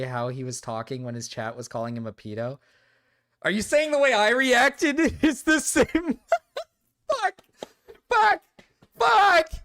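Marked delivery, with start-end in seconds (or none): 3.4-6.2 laughing in disbelief. Real, not acted. Laughs while reacting. 7.1-9.5 sharp, much higher, louder swearing; repeated loud cursing in defeat; exasperated curses. Real, not acted. Dismayed outburst after comparison.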